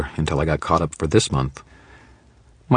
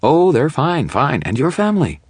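much higher, sickly-sweet mock gushing; sugary gush; 9 semitones higher, 4 dB louder than usual. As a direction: lay the sarcasm on thick. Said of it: "I gushed, overflowing with saccharin".

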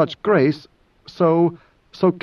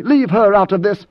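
higher-pitched, protective interruption; sharp warning; 6 semitones higher, 4 dB louder than usual. Acted. Idaho stops Moneo speaking of Hwi, sharp warning. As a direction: cut in sharply to warn him off.

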